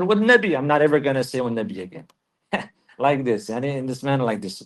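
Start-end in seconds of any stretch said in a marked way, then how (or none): 0.0-2.1 put-on a man slipping into his old habit while promising to stop; blurted slip. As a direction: blurt it out, then vow never again. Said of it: "He said".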